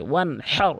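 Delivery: ওয়ানহার with mid-throat breathy h; throaty ha. Shown as a demonstration. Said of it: "হাঁটি হলকের মাঝখান থেকে". (ওয়ানহার-এর হা হলকের মাঝখান থেকে)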